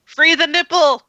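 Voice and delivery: high, loud cheer; mock rallying cry. Streamer cheering on shirtless friends, mock rallying cry. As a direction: shout it out like a joking slogan.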